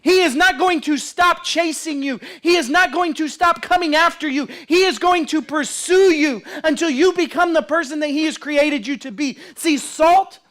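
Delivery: high, insistent sermon delivery; impassioned preacher in full flow; full-force preaching. Real, not acted. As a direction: preach it hard, driving each phrase home.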